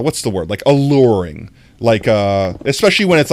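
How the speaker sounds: ordinary talk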